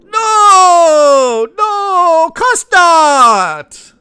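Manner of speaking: very high, loud, drawn-out mock fawning; high, slow and loud mock-sweet "of course not"; fawning reassurance. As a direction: coo it like a doting flatterer, slowly. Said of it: "And Macron is going".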